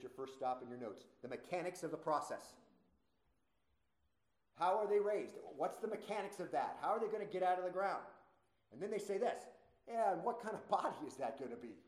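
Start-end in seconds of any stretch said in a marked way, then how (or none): 4.5-8.0 raised-pitch, incredulous mockery; mocking questions. Preacher voices critics doubting the mechanics, mocking. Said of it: "that's mockingly asking about the mechanics". 9.9-11.8 put-on scoffing question with a mock "ooh". Preacher plays critics asking about the body, mocking. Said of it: "they mockingly ask that question".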